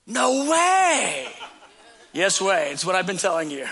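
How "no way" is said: higher, louder no way through a laugh; amazed disbelief. Retold or imitated. A listener hears of round-the-clock worship, laughing in disbelief. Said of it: "And they go".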